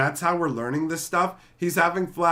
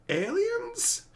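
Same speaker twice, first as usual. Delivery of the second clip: much higher incredulous rise; disbelieving question; 4 dB quieter than usual. Retold or imitated. Realising the people are aliens, high disbelief. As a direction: squeak it in disbelief. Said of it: "And I'm like".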